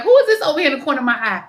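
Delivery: high, fast, startled; quick surprised question. Retold or imitated. Psychic spotting a spirit in the corner, fast surprised ask. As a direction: rush out a surprised who-is-this. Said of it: "I was like".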